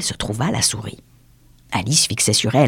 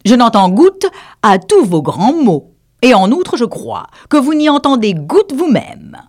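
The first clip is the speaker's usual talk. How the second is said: louder, cheeky jab; cheeky eaglet; 11 semitones higher, 6 dB louder than usual. Acted. The eaglet mocking the dodo's big words, cheeky. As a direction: needle the dodo.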